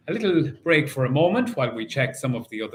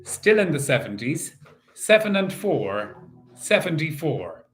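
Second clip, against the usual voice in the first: bingo caller; 4 semitones higher than usual. Acted. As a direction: call the numbers like a bingo hall host. Calling bingo numbers in rhythm.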